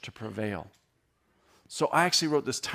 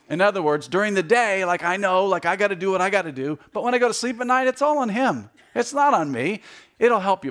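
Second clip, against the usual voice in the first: higher and louder, driving the point home; full-force preaching; 6 semitones higher, 5 dB louder than usual. Real, not acted. To the congregation explaining Arminian and Calvinist, fervent preaching. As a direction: preach it out with rising fire.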